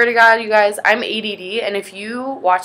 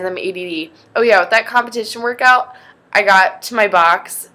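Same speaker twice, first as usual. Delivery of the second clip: ordinary talk, nothing marked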